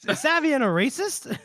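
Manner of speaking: ordinary delivery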